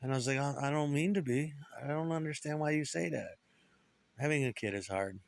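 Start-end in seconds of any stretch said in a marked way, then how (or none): 0.4-3.4 put-on higher-pitched young self answering back; hurt child's reply. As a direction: plead in a higher, boyish voice. His childhood answer to accusing father.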